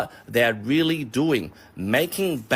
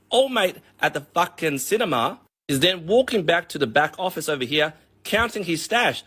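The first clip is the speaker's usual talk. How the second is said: ordinary talk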